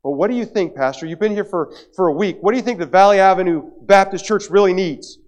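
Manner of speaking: loud, high-pitched acted questioning; insistent congregant. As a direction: belt it out at the pastor. Acting a congregant questioning the pastor.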